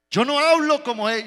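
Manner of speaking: mock-outraged protest; indignant denial. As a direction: snap back a flat denial. [name] denies angrily.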